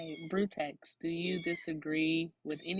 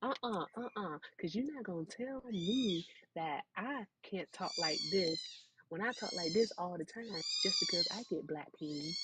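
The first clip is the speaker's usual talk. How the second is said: a higher, sassy put-on voice, lightly done; 8 semitones higher than usual. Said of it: "switch to the A A V E".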